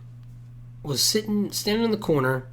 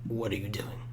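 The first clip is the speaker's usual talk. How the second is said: quiet confrontation; 6 semitones lower, 14 dB quieter than usual. Retold or imitated. Confronting the friend.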